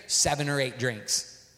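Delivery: ordinary talk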